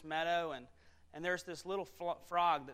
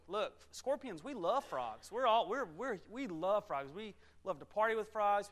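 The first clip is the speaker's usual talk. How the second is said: eager, slightly raised sweet-talking patter; persuasive scorpion; 3 semitones higher, 4 dB louder than usual. Acted. Scorpion tells the frog times have changed, eager persuading. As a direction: brush off the worry and win them over.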